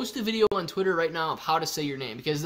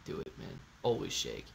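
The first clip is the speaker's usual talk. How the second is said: unvoiced, very soft mutter; whisper; 10 semitones lower, 14 dB quieter than usual. Real, not acted. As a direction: whisper it under your breath. Muttered to himself.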